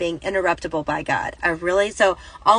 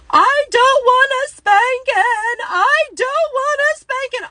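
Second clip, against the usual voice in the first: put-on much higher, slow, drawn-out child's wail; imitating a wailing child; 5 dB louder, slower than usual. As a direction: mimic a toddler in meltdown crying out. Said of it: "Eli starts screaming even more".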